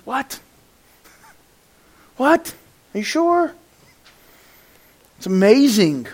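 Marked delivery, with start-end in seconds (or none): 0.0-3.5 high, incredulous questioning; mock disbelief. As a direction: query it in squeaked, doubtful bewilderment. Acted disbelief at James's verse.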